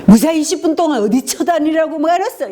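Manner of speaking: the wrong, furious way; angry shout. Shown as a demonstration. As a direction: yell at a late spouse. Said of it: "이게 아니라".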